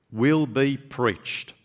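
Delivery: "will be preached" drawn out very slowly, each word weighted; emphatic repetition. Real, not acted. Preacher repeating the verse to congregation, "will be preached" slow and emphatic.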